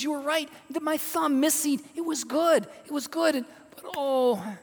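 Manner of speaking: high, tumbling, excited admission; overwrought realization. Acted. King telling freed friend he was right, high and agitated. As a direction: spill it out high-pitched, breaking into an oh.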